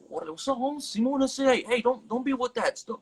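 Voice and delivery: a hammed-up OG cousin telling him off; imitating OG cousins. Retelling how his OG cousins scolded him, in a high mock voice. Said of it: "They, they were like".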